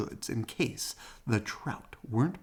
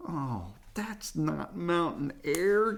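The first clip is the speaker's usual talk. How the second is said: complaining; 7 semitones higher, slower than usual. A narrator's reading. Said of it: "complained Brother".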